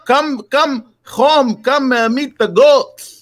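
put-on high, slow Greek-accented uncle; imitating a Greek farmer uncle, slow and high; mock Greek accent of the old uncle; uncle's Greek accent. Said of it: "he says".

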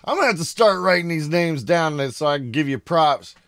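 ordinary talk

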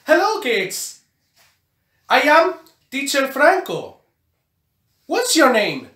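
ordinary delivery

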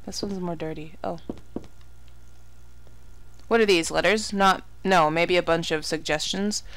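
ordinary talk, nothing marked

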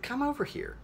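very high, light come-hither call; high beckoning call. Retold or imitated. Woman at her window inviting the new neighbor over, high beckoning call.

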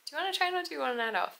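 high, quick sing-song ask; quoted text question. Retold or imitated. Coach texting her student about tonight's class, high and breezy. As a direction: float the question up high and fast. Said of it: "I messaged my one girl ... was like".

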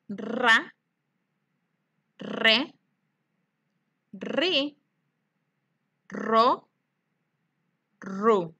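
each syllable said clearly with long pauses for the echo; syllable drill. Shown as a demonstration. Teacher leading children to repeat syllables. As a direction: leave room after each syllable for the class to repeat. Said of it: "Repitan conmigo".

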